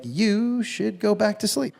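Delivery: drawn out slowly, dismissive; slow dry brush-off to the critic; slow put-down. Retold or imitated. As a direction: let each word drag, unimpressed. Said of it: "I was like".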